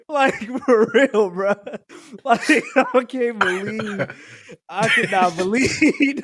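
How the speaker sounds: slow, high, through laughter; wheezing disbelief between laughs; laughing too hard to finish. Real, not acted. Still laughing at the lyric.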